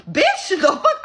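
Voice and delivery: high, loud mock outburst; incredulous outrage. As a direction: burst out high in disbelief. Outraged retort in retold quarrel.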